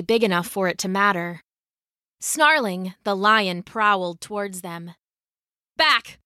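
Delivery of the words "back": high, sharp warning cry; urgent one-word order, pitched up; cried command. A narrator's reading. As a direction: cry out to drive off a beast. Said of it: "Lydia cried".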